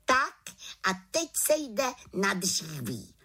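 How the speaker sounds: little witch's brisk announcement; calling out. Acted. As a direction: call everyone to work. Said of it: "zvolala malá čarodějnice".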